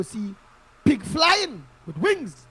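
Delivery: scoffing sing-song ridicule; mocking scorn. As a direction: stretch it out like it's a joke. Mocking an impossible prediction.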